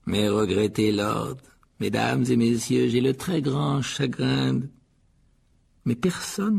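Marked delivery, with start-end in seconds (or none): 0.1-4.7 slow, solemn, projected oration; Nick's speech. A narrator's reading. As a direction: draw out each phrase ceremoniously. Nick prononce son discours. (discours solennel et lent)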